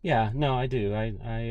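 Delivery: ordinary talk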